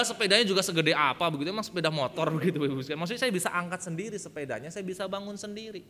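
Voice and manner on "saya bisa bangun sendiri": ordinary talk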